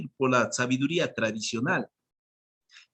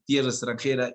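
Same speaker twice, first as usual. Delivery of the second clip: ordinary talk, nothing marked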